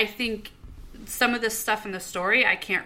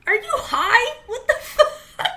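very high, loud squawk of disbelief; incredulous outrage; 10 dB louder, slower than usual. Real, not acted. Co-host scolds reviewer for calling E T average, incredulous outrage. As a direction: shriek it, genuinely appalled.

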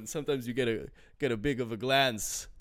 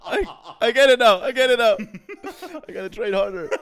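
laughing; 9 semitones higher, 11 dB louder, faster than usual. Real, not acted. Loud laughing realization.

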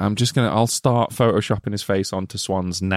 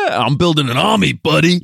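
put-on supervillain voice, higher and far off; mock villain; 6 semitones higher than usual. Co-host jokes back at the Lex Luther jab, in a mock villain voice. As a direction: ham up a cartoon baddie.

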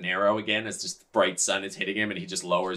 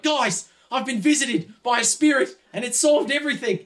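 high, loud proclamation; overjoyed, pitched-up calling out; ecstatic announcement; 5 dB louder than usual. Acted. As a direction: proclaim a miracle to the whole group. Yuri announces his vision to everyone.

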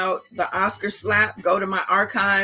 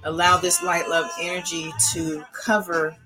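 calm meditative tone. Acted. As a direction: guide them gently into calm breathing. Leads a guided breathing meditation.

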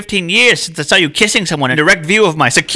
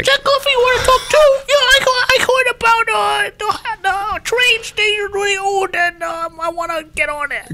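mock drunk. Cohost teasing that he's been drinking, hammed-up tipsy voice. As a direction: ham up a silly buzzed ramble. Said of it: "You've been drinking".

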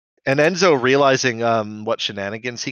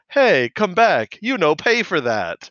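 higher, drawn-out mock yell; retold yell; 7 semitones higher, 3 dB louder, slower than usual. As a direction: imitate a sister yelling after a thief. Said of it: "his sister yelled".

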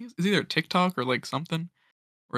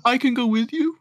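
high mock voice; 10 semitones higher than usual. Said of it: "the person's like".

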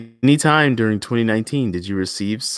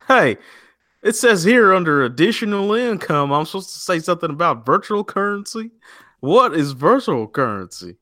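put-on Alabama man accent; 8 semitones higher, 3 dB louder than usual. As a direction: play a dumb Alabama man asking the taxman. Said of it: "Alabama man accent right there".

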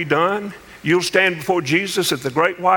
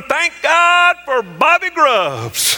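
high, long-drawn holler; joyful hollering; 9 semitones higher, 3 dB louder, much slower than usual. Retold or imitated. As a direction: holler it slowly across the mountains. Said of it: "running them mountains hollering".

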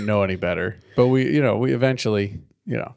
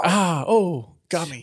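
mock shot; 8 semitones higher, slower than usual. Pretending to be shot.

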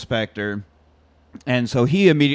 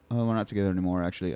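quieter, trailing off; deflated mumble; 6 semitones lower, 8 dB quieter, much faster than usual. Retold or imitated. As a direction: say it flatly, a bit embarrassed. Nicholas voiced, dejected reply.

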